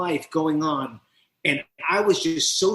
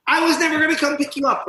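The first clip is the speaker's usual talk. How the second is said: much higher, louder, faster taunt; harsher mocking taunt; 12 semitones higher, 5 dB louder, faster than usual. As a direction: rub it in harder. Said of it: "all of a sudden I heard".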